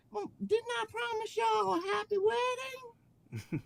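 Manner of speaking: slow twangy drawl in high falsetto; LaFranc's twangy accent. Acted. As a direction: put on a high, drawn-out twang. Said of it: "in his brand new twangy accent".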